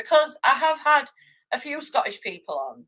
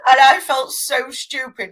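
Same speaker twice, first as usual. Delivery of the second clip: usual speaking voice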